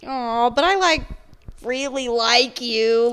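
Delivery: high, loud, slowed-down plea; drawn-out pleading aw; mock pleading aw. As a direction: coo it like you're begging him not to go. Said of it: "Like, I'm like".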